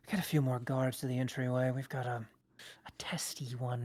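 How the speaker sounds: quick hushed order into a stone, fading to a whisper. Acted. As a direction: murmur the order fast, then whisper. GM voices innkeeper on stone.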